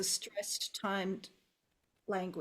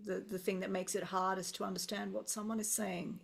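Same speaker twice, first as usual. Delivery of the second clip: ordinary talk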